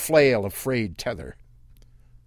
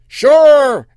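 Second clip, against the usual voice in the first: high, loud called-out yes; yelled; 16 dB louder than usual. Acted. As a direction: shout it across the sand. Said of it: "Earl yelled".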